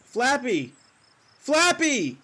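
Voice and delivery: high, drawn call that falls away. Acted. Man searching for his bird, calling out high. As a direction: call into the distance for a lost companion.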